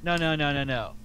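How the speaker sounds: ordinary talk, nothing marked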